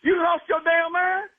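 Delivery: a husband who can't believe what he heard; outraged. Real, not acted. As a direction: throw it back at him, stunned and angry. Husband outraged at prank doctor.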